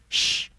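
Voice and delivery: long hissed shush; hushing shh. Acted. Rabbit hushes Pooh while listening, long shh. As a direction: hush them with a drawn-out shh.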